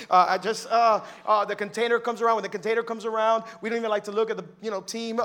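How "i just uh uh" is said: usual speaking voice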